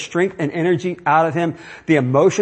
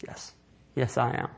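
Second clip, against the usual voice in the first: quiet resolve; 3 semitones lower, 12 dB quieter than usual. Acted. Abraham answering the devil.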